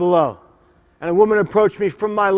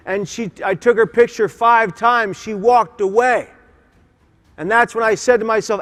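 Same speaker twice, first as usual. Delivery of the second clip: usual speaking voice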